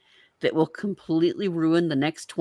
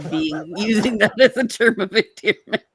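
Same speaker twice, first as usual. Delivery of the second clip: laughing; 4 semitones higher, 4 dB louder than usual. Real, not acted. Chalupa as a loving nickname.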